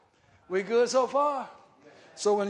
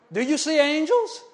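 normal talking voice

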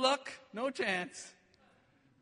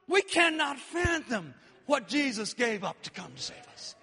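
normal talking voice

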